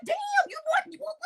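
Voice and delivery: very high, shocked question; high incredulous you what; disbelief. Retold or imitated. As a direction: squeal out the disbelief. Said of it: "I was like".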